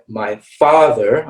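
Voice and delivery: father with a long open back "ah", said slowly. Shown as a demonstration. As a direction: stretch the "ah" in father, mouth wide and unrounded. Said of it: "So my".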